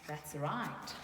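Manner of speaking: ordinary delivery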